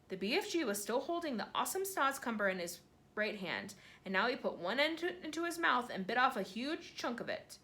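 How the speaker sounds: usual speaking voice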